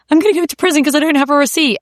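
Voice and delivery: high, fast, frantic sobbing voice; panicked crying. Retold or imitated. Ringing her husband in tears over a lost receipt, high frantic panic. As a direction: blurt it out in a panic. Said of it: "I rang my husband crying".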